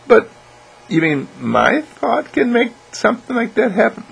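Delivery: put-on very high, amazed little kid voice; wondering child. Child asks Mister Candle about thoughts, amazed. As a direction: ask it like a child discovering something.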